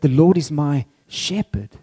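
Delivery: "shepherd" set apart and punched as the key word; stress on shepherd. Shown as a demonstration. Preacher showing God described as a shepherd, stress on "shepherd". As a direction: save the emphasis for the final "shepherd". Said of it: "depending on where you put the emphasis".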